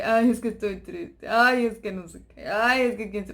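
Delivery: whiny sighing "ay" on every line, imitating a brooder; mock self-pity. Preacher imitating someone wallowing in sadness, mock whine. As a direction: play a moping believer feeling sorry for herself.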